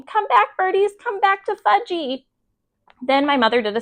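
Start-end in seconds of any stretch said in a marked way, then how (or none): 0.0-2.3 put-on higher childlike wail after birds; toddler calling. A narrator's reading. Fudge calling the pigeons back, childlike wail. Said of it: "was screaming".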